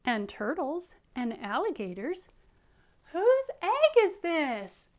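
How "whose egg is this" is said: high, loud, drawn-out call; storybook character calling loudly. Acted. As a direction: call it out slowly across a distance. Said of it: "he called".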